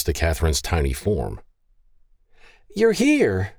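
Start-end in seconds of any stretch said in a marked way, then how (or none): 2.7-3.5 excited high exclamation; gleeful cry. A narrator's reading. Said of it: "Aaron shouted with glee".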